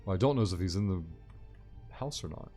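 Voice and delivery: usual speaking voice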